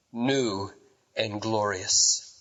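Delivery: ordinary delivery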